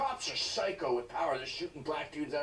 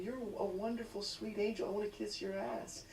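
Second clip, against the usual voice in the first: softer, gushing mock sweetness; mock Hillary gush; 3 semitones higher, 6 dB quieter than usual. Hillary praising the boy, soft mock cooing. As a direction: coo it sarcastically. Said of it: "Hillary chiming in saying".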